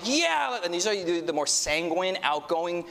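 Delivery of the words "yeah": much higher, eager shout of yeah. Retold or imitated. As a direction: jump at the chance. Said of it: "courageous people that are like".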